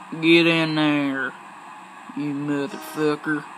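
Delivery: hammed-up, drawling country accent; horrible country accent. Player scolding a game object, mock country accent. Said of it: "That's a horrible country accent".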